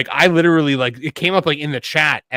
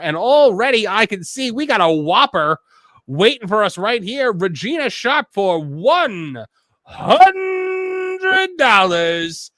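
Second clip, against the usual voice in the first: hype announcer; 5 semitones higher, slower than usual. Real, not acted. Host announcing a viewer's big donation, hyped and drawn out. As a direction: stretch the amount out high like a ring announcer.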